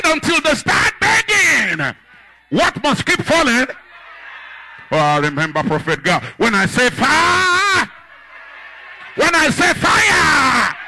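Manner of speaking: high, loud pulpit cries; full-force preaching. Real, not acted. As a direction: thunder it out like a revival preacher.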